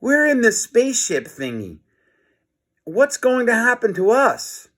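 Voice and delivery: high, worried pleading; alarmed cry. Acted. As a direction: panic rising as he realises. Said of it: "he cried".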